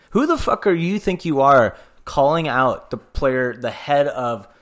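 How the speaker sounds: heated outburst at the Rangers; angry rant. Real, not acted. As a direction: vent at the team, getting worked up. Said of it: "I'm getting so angry".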